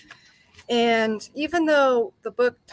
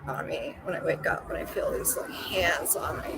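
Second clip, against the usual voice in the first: whispering; 5 semitones lower, 8 dB quieter than usual. Retold or imitated. Retelling hands on her throat.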